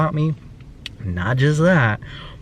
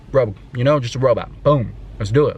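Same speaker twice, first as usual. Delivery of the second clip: ordinary talk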